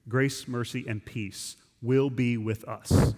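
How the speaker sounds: "Grace, mercy, and peace will be with us" read slowly, each word weighted; emphatic reading. Shown as a demonstration. Preacher reading John's blessing to the church, slow and emphatic. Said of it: "You hear the em-, emphasis?".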